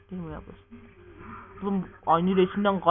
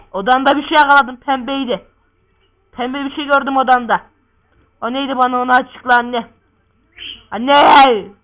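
loud, raised voice of a demanding son; insistent calling; 2 semitones higher, 10 dB louder than usual. Acted. Son demanding mother explain his find, insistent shouting.

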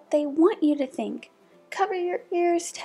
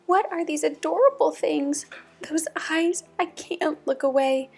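higher-pitched mock amazement; awestruck; 6 semitones higher than usual. Townsperson first sees the adorable puppies, awestruck. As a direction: gape at something irresistibly cute.

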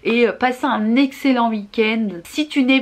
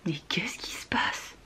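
soft unvoiced whisper; whispered aside; 4 semitones lower, 13 dB quieter than usual. Real, not acted. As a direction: whisper it in disbelief. Whispered aside during scene.

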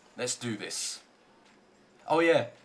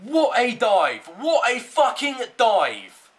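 louder and slow, disbelieving fury; outraged yell; 4 semitones higher, 5 dB louder, slower than usual. Real, not acted. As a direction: drag it out in disgust. Outraged at opponent's dive.

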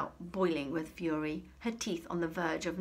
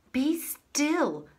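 high, loud stern command; demanding; 10 semitones higher, 8 dB louder than usual. A narrator's reading. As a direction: order her to stop at once. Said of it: "demanded Miss Prigg".

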